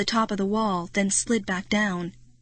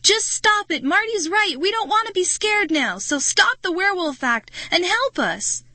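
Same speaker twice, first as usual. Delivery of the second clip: high, loud furious scream; 11 semitones higher, 4 dB louder than usual. Acted. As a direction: lose it and scream at the werewolves. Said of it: "I screamed".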